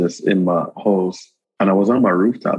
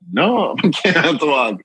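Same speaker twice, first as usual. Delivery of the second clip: ordinary delivery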